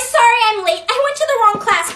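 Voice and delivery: usual speaking voice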